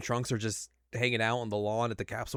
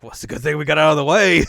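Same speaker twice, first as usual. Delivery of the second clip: fast, loud, relieved exclamation; relieved shout; 5 semitones higher, 12 dB louder, faster than usual. Retold or imitated. Goku and Vegeta after dodging blast, loud fast relief. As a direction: blurt it out quick and loud. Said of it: "they're like".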